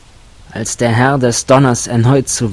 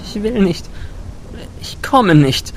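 put-on high, scared girl faking defiance; stubborn refusal that hides fear; frightened girl defying the storm lord; defiant undertone; 9 semitones higher than usual. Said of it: "mit trotzig klingendem Unterton".

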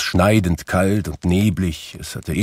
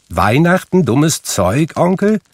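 higher, louder, teasing disbelief; cheerful young nephew; 9 semitones higher, 4 dB louder than usual. A narrator's reading. Nephew disbelieving his uncle's humbug, cheerfully. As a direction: toss it back with a laugh in the voice.

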